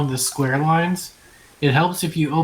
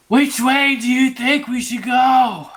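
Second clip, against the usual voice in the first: full shout, high and slow; whisper yelling; 7 semitones higher, 4 dB louder, slower than usual. Acted. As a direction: yell it right in their face, slowly. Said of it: "He's being very loud".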